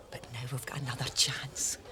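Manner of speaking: hushed, secretive whisper; conspiratorial whisper. Acted. Ella whispers her plan to Mary, hushed. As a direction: drop to a whisper to share the scheme. Plotting to leave husbands.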